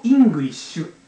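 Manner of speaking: イングリッシュ with Japanese "i" and a full final "shu"; literal katakana reading. Shown as a demonstration. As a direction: spell イングリッシュ out in even Japanese syllables. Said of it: "そのまま言うと".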